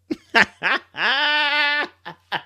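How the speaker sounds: high guffaw at the line; incredulous laugh. Real, not acted. Streamer laughs at the celebrity's apology. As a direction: burst out laughing despite yourself.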